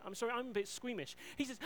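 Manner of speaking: ordinary talk, nothing marked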